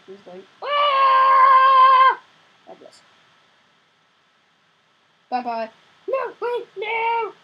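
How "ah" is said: long, slow, high, loud hammed-up ah; high drawn-out hammed-up wail; mock dying wail. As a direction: stretch out a mock death cry.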